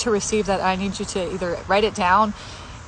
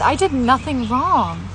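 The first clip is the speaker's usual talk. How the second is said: slow, higher, tear-choked; real crying, slow and higher; 5 semitones higher, slower than usual. Real, not acted. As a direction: let the tears break the words. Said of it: "it literally made me cry".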